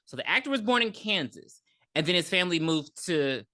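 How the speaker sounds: normal talking voice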